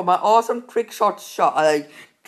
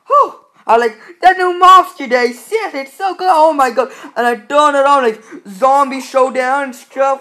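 much higher, loud excited squeal; overexcited squeal; 5 semitones higher, 9 dB louder than usual. Real, not acted. Gamer relieved at no mobs, high squeal. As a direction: burst out in relief.

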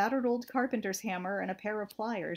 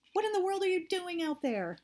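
much higher call across the dark; shouting back; 9 semitones higher than usual. A narrator's reading. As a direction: shout to someone far off. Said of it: "I shouted back".

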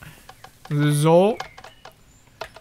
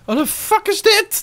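shocked disbelief. Real, not acted. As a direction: yelp it in disbelief. Confused shock in game.

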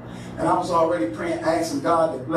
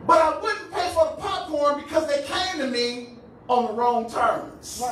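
raised-pitch sermon delivery; preaching; 8 semitones higher than usual. Real, not acted. Preacher explaining why he withheld the popcorn, preaching. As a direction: preach it out, then settle down on the last words.

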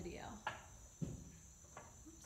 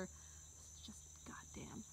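much softer, unvoiced whisper; whispered curse; 3 semitones lower, 15 dB quieter, much slower than usual. Real, not acted. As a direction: breathe the curse under your breath. Muttered curse under breath.

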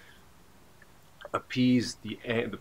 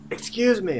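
high, raised-voice excuse me; pitched up and louder, politely insistent; kinda loud; 13 semitones higher, 6 dB louder than usual. Retold or imitated. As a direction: call out to get a driver's attention. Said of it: "kinda loud".

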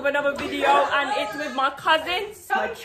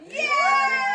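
excited cheer; 13 semitones higher than usual. Real, not acted. Hyping up cousins.

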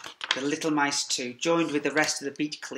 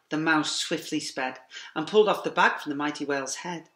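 ordinary delivery, nothing marked

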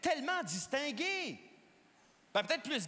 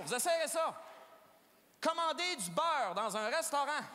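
usual speaking voice

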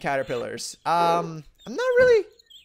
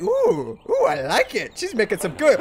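high eager voice; high cooing pleasure; delighted Gandalf; 7 semitones higher than usual. Retold or imitated. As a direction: coo with delight. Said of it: "He's like".

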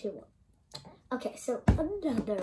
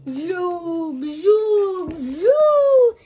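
getting louder and higher; zoom effect; 6 semitones higher, 10 dB louder, much slower than usual. Pretend. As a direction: build each zoom up. Toy zooming sound.

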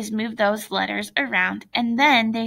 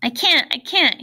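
understated tearful protest, pitched up; a touch of crying in a raised pitch; 4 semitones higher, 4 dB louder than usual. A narrator's reading. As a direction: read as mildly upset, higher voice. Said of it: "he was crying".

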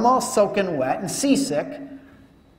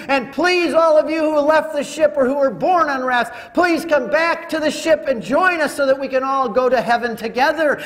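pleading call; 6 semitones higher, 4 dB louder than usual. Acted. Calling rafts back through megaphone.

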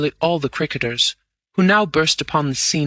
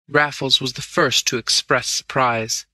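ordinary delivery, nothing marked

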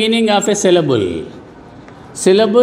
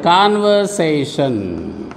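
slow, drawn-out "conversation", lower in pitch; syllable by syllable; 5 semitones lower, slower than usual. Shown as a demonstration. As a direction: stretch out each syllable of "conversation". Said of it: "divided into syllables".

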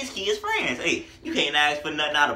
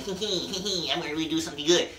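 giggly, fast mock-sneaky voice; hammed-up scheming rapper impression, rushed; faster than usual. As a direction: sell the hype with a sly little giggle. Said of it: "someone saying".

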